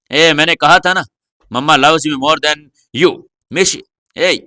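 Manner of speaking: plain reading voice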